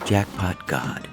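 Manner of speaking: ordinary delivery, nothing marked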